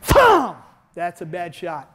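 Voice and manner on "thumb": high, loud thump for the strike; striking demo sound effect; shouted hit sound. Shown as a demonstration. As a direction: punch the sound out. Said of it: "hand up".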